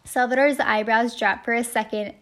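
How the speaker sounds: ordinary delivery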